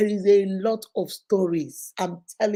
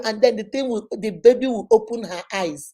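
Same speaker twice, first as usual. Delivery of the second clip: usual speaking voice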